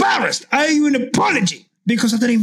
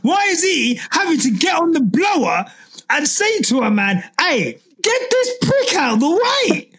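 ranting shout; 8 semitones higher than usual. Real, not acted. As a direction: shout it out in disbelief. Ranting about team calling driver.

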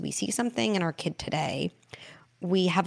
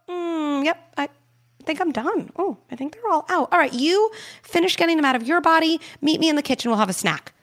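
much higher, light, cheerful voice; breezy and bright, the game is over; playful; 10 semitones higher, 5 dB louder than usual. Shown as a demonstration. Playful game ends with child.

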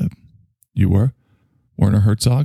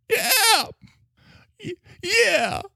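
high, indignant little cries; overwrought; 4 dB quieter, much slower than usual. Acted. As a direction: squeal it in melodramatic pique. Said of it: "You're really feeling a lot".

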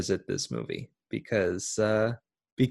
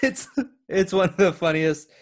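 laughing; 6 semitones higher than usual. Real, not acted.

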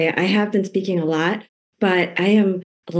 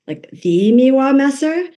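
high, slow incredulous question; impressed child's question; 7 semitones higher, 4 dB louder than usual. Retold or imitated. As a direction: quote an awestruck kid, pitched up and drawn out. Voices her impressed child's question.

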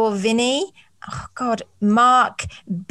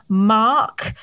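ordinary talk, nothing marked

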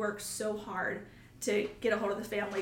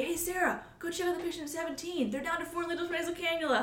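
higher, quick, cheery colleague voice; imitating Clayton; 8 semitones higher, faster than usual. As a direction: bring good news to a tired nurse, bright and high. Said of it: "Clayton would say".

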